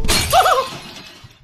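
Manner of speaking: a burst of squealing laughter up high; shrill laugh at the stunt; high shrieking laugh. Real, not acted. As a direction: let out a piercing cackle. Laughs after stunt landing.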